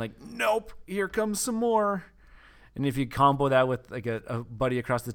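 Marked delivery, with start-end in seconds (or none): 0.2-2.0 gleeful gamer taunt. Acted. Gleeful game move quote.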